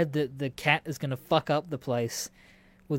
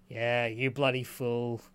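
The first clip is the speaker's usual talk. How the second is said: lower duck mutter; 5 semitones lower, 4 dB quieter, slower than usual. Retold or imitated. Imitating the duck while mating.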